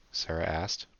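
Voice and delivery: ordinary delivery, nothing marked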